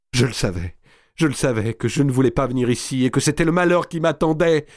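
high distressed outburst, building; anguished lament. A narrator's reading. Marie se lamente sur son malheur. (lamentation désespérée qui monte)

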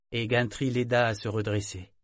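ordinary delivery

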